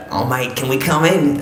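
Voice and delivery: imitating a matey Australian accent; Australian neighbour accent. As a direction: put on an Aussie neighbour's accent. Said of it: "that's not how they speak".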